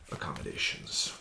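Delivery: usual speaking voice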